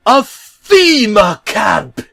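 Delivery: drawn-out high shout, stretching each word; slow gleeful shout. Acted. Bob announcing the camp to the trucks, in a slow high shout. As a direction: boom it out slowly and proudly. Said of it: "Bob yelled happily".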